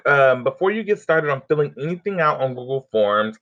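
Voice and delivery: normal talking voice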